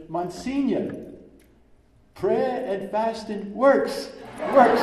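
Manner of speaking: man shouting to the priest from mid-avenue; shouting back. Retold or imitated. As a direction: call out joyfully over the traffic. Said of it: "he shouts back to me".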